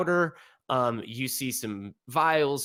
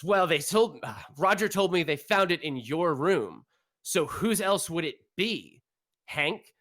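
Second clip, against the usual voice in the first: accusing father; 6 semitones higher than usual. Acted. GM voices angry father accusing son.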